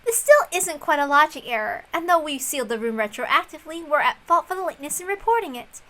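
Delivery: high, light voice of a sly female opponent; confident game opponent. Acted. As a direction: toy with the rival, politely superior. Plays a female game figure.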